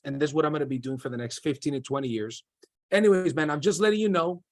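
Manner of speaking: quick run-through of a sales script; brisk, read-off-the-page phone pitch; fast script read. Shown as a demonstration. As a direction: rattle through the script, not in conversational tone. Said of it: "I am reading it fast".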